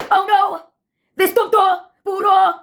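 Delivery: put-on high-pitched theater-kid outburst; theatrical scream. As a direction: play it like a melodramatic musical chorus. Recurring theater-kid chorus.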